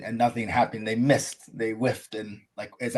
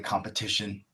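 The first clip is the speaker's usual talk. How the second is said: normal talking voice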